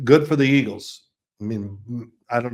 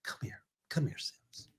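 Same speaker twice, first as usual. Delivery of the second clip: hushed, much softer sideline whisper; coach whispering; 2 semitones lower, 17 dB quieter than usual. Retold or imitated. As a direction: whisper it so only Sims hears. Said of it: "he goes".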